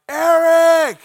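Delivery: high, loud holler back to a friend; yelled answer. Retold or imitated. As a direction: stretch the name in a big shout. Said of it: "I would scream at Adrian".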